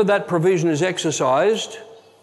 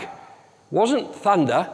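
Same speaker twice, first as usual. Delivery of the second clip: ordinary delivery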